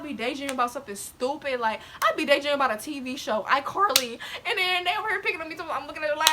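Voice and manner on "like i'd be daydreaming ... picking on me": usual speaking voice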